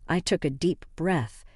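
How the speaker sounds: ordinary delivery, nothing marked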